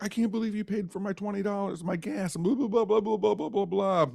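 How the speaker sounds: high, overjoyed gushing; high excited babble of thanks. Retold or imitated. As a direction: rave like you can't believe your luck. Grateful driver gushing.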